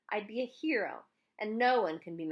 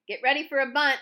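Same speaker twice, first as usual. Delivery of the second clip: loud taunting yell; 7 semitones higher, 10 dB louder than usual. A narrator's reading. Pitcher yelling to his infield, loud and taunting. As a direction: call it out loud to tip off the bunt. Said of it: "yelled T J again".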